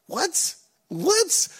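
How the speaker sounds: very high incredulous what. Real, not acted. Preacher to church, high incredulous what. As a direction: rise right up in disbelief.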